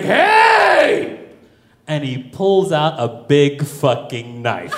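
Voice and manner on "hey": sharp high barked hey; guard's shout. Retold or imitated. Russian guard yelling at the couple, loud bark. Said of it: "the Russian guy's like".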